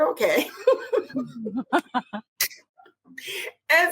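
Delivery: giggly laughing okay. Retold or imitated. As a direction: laugh while agreeing. Drunk agreement laughed.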